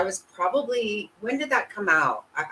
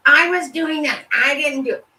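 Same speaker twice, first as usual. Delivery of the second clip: high, louder sneering mimic of her excuses; mocking the liar; 10 semitones higher, 6 dB louder than usual. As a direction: parody her self-important claims. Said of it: "she wants to be like".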